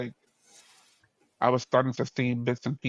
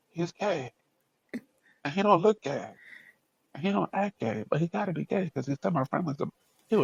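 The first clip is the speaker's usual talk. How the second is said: higher, put-on puzzled voice; incredulous reasoning; 5 semitones higher than usual. Student puzzling over a classmate's remark, higher incredulous voice. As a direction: work it out like it makes no sense.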